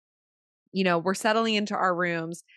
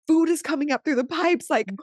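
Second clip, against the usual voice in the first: high, louder panicked report; frantic alarm; 8 semitones higher, 3 dB louder than usual. Retold or imitated. Texting Dana and Mo about the toilets, high frantic alarm. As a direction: sound the alarm, half-laughing panic.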